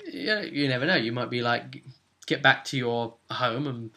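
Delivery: normal talking voice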